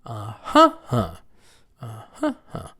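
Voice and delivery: wordless uh HUH-huh prompt; first-syllable stress. Shown as a demonstration. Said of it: "How about the noun?".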